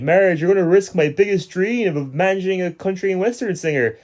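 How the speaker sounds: high hammed-up Homer; mock Homer whine. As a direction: protest like a wounded dreamer. Quoting Homer.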